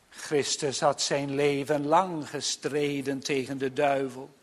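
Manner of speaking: usual speaking voice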